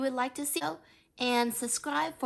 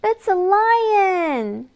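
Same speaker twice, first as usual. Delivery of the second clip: higher, louder, slow dramatic reveal; excited reveal; 8 semitones higher, 10 dB louder, much slower than usual. Acted. Reader showing the child the lion, slow and thrilled. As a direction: unveil it with wide-eyed drama.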